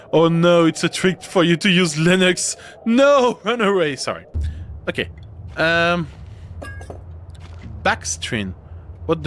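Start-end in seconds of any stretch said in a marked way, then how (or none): 0.1-4.0 loud, high, hammed-up alarm; mock panic. Streamer warning viewers off a Linux trick, in mock panic. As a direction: sound a playful false alarm, pitched up.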